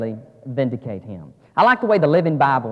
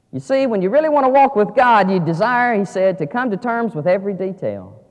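raised, forceful pulpit delivery easing down; emphatic preaching; 6 semitones higher, 7 dB louder than usual. Real, not acted. Preacher urging listeners to walk with God, emphatic preaching. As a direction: press the point home firmly.

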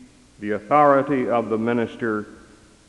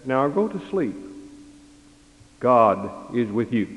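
mild parental hush. Acted. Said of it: "And the parents said".

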